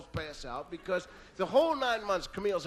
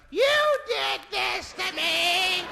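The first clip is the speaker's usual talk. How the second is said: high, loud, drawn-out accusation; furious wife; 10 semitones higher, 5 dB louder, much slower than usual. Retold or imitated. Wife turning on husband in labour, enraged drawn-out screech. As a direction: wail the blame at him slowly. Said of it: "She turns around".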